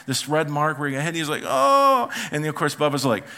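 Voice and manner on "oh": high, loud mock wail; imitated hurt moan. Grandson showing his red mark, mock wailing.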